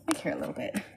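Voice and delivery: whispered under the breath, no voice. Shown as a demonstration. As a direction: breathe the line as a private aside.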